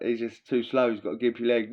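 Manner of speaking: normal talking voice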